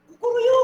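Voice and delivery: high crowing kukuruyu; rooster crow. Retold or imitated. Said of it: "kata Isabel tuh".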